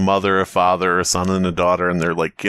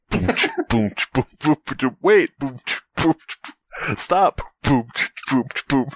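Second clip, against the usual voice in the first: beatboxing; 4 semitones higher than usual. Pretend. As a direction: mouth beat with booms and clicks. Beatboxing a beat.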